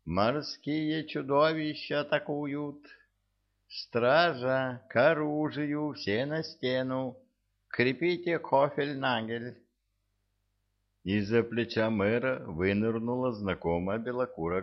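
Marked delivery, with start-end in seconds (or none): 0.0-9.6 alarmed shouting. Acted. Mayor raises alarm.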